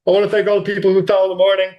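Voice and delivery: quick hammed-up victory speech; champion's acceptance speech. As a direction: rattle off thanks like a winner at the mic. Mock athlete's acceptance speech.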